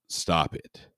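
mild groan from the alien; lightly voiced groaning command; understated creature groan. A narrator's reading. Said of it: "The voice groaned".

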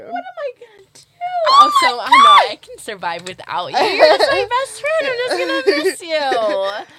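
tearful goodbye. Pretend. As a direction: wail it like a mock breakdown. Mock sobbing goodbye to friend.

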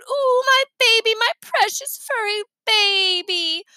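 high, drawn-out gushing over a beloved puppy; cooing. A narrator's reading. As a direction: coo adoringly as if to a baby. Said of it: "Instead, she cooed".

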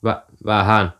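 stammered first syllable, reluctant; a touch of nervousness; hesitant admission. A narrator's reading. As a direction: admit it haltingly. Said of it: "Jukka-Pekka tunnusti".

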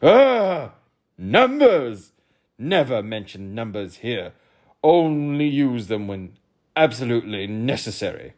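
demanding king; pompous king. Acted. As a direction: quiz the boy grandly. King voice held.

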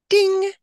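a high, loud "ding" like a message alert; imitated ding. Mother mimics the message arriving: high, loud ding. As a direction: sing out the alert chime.

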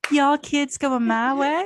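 laughing creepy Southern stranger; creepy Southern accent. Acted.

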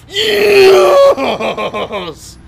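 long, high, full-volume yell of yes; hammed-up director screaming in triumph; triumphant scream. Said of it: "he's just like".

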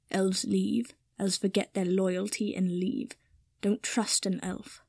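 slow, soft servant voice; a hint of grave warning, slowly and quietly; lightly voiced grave servant. Kipper warns Draco about elves, slow, grave servant voice.

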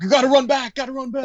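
high squeaky mock urgency; mock urgent rider. Friend mimicking a rider racing back to Everest, high mock urgency. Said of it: "You're like".

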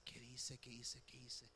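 whispered worried thoughts, over and over; hushed, baffled self-question; panicked whisper. Retold or imitated. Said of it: "yo pensé".

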